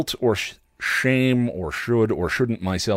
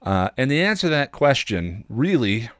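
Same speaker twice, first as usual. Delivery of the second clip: ordinary talk, nothing marked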